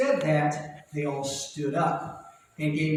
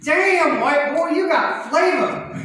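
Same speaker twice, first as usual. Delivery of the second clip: high, loud, drawn-out amazed praise; impressed club owner; 11 semitones higher, 4 dB louder, much slower than usual. Retold or imitated. Club owner raving to the comic after his set. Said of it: "and she goes".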